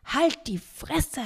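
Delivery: high, drawn-out rude yell; shouted back. Acted. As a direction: heckle from across the water. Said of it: "schallt es zurück".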